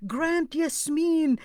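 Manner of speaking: high, drawn-out supplication; fervent prayer. Retold or imitated. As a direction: plead to God aloud, slowly and insistently. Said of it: "I was saying".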